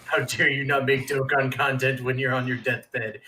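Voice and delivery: ordinary talk, nothing marked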